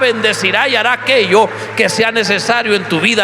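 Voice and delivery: rising to a loud, fervent proclamation; full-force preaching. Real, not acted. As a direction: build the blessing to a ringing climax.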